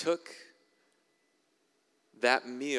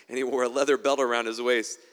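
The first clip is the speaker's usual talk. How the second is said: louder, punching the description; emphatic loud read; 9 dB louder than usual. Acted. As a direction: belt it out for the congregation. Reading the hairy man description.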